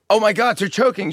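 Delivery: high alarmed woman; imitating a diner. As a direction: cry out that they're choking, up high. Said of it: "a woman down four tables goes".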